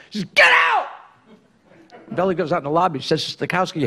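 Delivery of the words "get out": angry shout. Acted. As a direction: bark it out, high and loud. Boss throws out the applicant.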